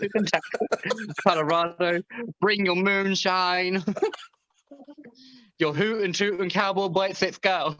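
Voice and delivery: high mock cowboy twang; playful fake cowboy accent; Texan accent.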